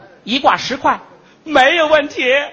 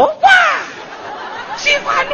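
sudden delight; 13 semitones higher than usual. Retold or imitated. Comic refrain, sudden delight.

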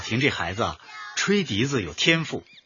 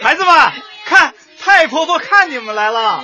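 put-on high, loud cheerful call to the kids; calling out to children; 6 dB louder than usual. Orphanage director calls the children to greet great-grandma, loud call. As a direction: announce great-grandma's arrival across the room.

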